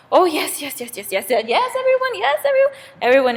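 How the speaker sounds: excited rapid-fire chorus of yeses, leaping high; eager agreement. Acted. Bees promising Zeus, eagerly agreeing. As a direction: agree loudly, tumbling over each other.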